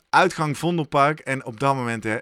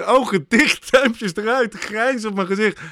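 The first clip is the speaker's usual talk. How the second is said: excited laughing recall; 6 semitones higher, 3 dB louder than usual. Real, not acted. Excited recall of finish photo.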